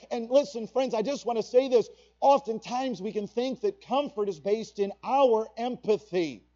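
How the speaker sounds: ordinary talk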